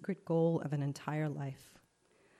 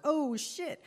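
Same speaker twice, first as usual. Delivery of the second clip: high, sudden "Oh, shit." jumping up in pitch; startled yelp; 11 semitones higher than usual. Retold or imitated. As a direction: jump back in shock on "Oh". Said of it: "Boys jump back like".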